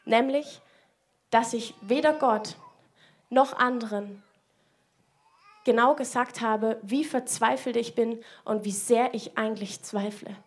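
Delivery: normal talking voice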